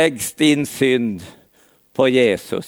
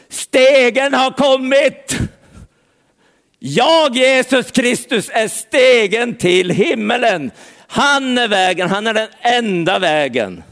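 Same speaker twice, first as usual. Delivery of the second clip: proclaiming Jesus; 6 semitones higher than usual. Acted. Jesus to Nathanael, solemnly proclaiming himself the ladder. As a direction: declare it like a herald from heaven.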